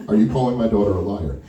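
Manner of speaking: tight-lipped father's accusation; stern challenge. Retold or imitated. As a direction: ask it low and level, as a warning. Father confronts the man, first asking.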